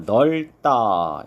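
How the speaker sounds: "넓다" as neol-tta, ㄹ kept and ㅂ silent; pronunciation demonstration. Shown as a demonstration. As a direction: say "넓다" as 널따 with a tense second syllable. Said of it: "이렇게 발음을 해야 되겠죠".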